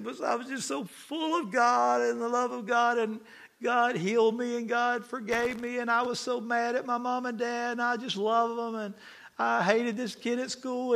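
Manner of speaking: high, weepy imitation of an overwhelmed kid; imitated tearful testimony. Preacher imitates kids giving camp testimonies, crying and gushing. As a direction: gush through mock tears, running on breathlessly from thought to thought. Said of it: "these kids are just crying their eyeballs out".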